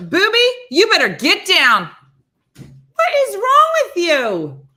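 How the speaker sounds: loud, high scolding yell at dog; yelling across the room. Real, not acted. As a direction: shout at the dog, drawn out.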